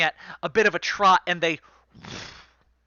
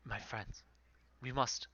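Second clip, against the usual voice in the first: hushed voice; 3 semitones lower, 15 dB quieter than usual. Acted. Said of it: "and he says".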